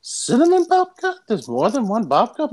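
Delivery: high, loud incredulous questioning; hammed-up disbelief, pitch leaping up; mock outrage. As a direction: play it as comic indignation. Acting out Seinfeld babka bit.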